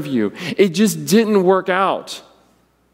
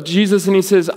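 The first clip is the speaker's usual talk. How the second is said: ordinary delivery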